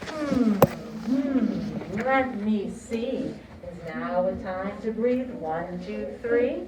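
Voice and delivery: loud, slow singalong chant; broad singsong kids theatre. Shown as a demonstration. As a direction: lead the class, big and bouncy. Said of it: "Let's say that together".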